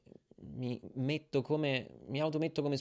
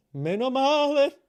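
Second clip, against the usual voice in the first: high, loud, drawn-out relieved cry; exaggerated relief; 12 semitones higher, 10 dB louder than usual. Real, not acted. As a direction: stretch it out, hugely relieved. Relief said three times.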